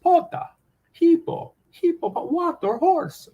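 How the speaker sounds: very high, drawn-out mock Greek; mock Greek translator. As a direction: translate word by word in a high put-on voice. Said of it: "that's my old Greek translations".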